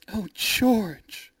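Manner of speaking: high-pitched swooning cry; delighted cry. A narrator's reading. Rosalind to George after the rescue, a high delighted cry. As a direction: gush in relief at her rescuer. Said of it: "Rosalind cried".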